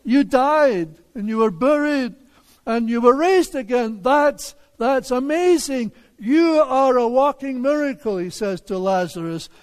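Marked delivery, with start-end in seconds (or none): 0.0-8.2 higher-pitched, emphatic astonishment; amazed neighbor. Acted. Neighbor marveling at Lazarus raised again, amazed. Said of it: "And the neighbor says".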